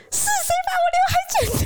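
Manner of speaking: put-on high, fast child's outraged yell; child's shrill furious shout; loud demand. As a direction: shout out who cut my bangs. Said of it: "很大声的问说".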